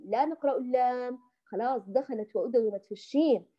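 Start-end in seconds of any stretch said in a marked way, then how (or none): none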